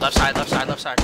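loud urgent shout; shouted callout. Real, not acted. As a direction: yell the direction at teammates. Calling out enemy direction.

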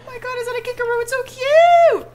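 high-pitched delighted squeal; squealing over cute animal. Real, not acted. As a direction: gush over something adorable. Sees a kangaroo in game.

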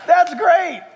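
playful voice of God looking down; God's amused delight. Retold or imitated. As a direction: boom it down from a cloud, pleased. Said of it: "God had to look over a cloud and go".

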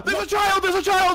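high, loud terrified shout; panicked scream. Real, not acted.

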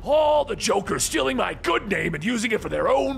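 high, drawn-out theatrical ranting; shouting Joker. A narrator's reading. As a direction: rant in mock sorrow and outrage. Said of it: "with the Joker shouting".

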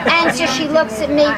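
ordinary talk, nothing marked

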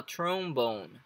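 trombone with the stress on the final BONE. Shown as a demonstration. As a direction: draw out and hit BONE in trombone. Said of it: "A entonação vai entrar aqui no bone". (trombone com a tônica no bone)

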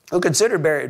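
usual speaking voice

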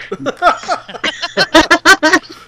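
squealing laugh, high and loud; high burst of laughter. Real, not acted. GM cracking up at the players' soap joke, high laughter. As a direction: let the laugh break out high.

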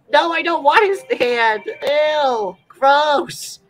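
high grossed-out squeal; disgusted. Real, not acted. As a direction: recoil in disgust. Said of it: "I don't know why I said that".

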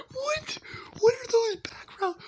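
very high squeaky disbelief, breathy whisper at the end; shocked squeal. Real, not acted. Shocked at old video noises.

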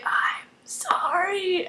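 very slow, faint whispered last words; drawn-out, hushed dying apology; mock dying whisper. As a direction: whisper it as if fading away. Acting dying shapeshifter's last words.

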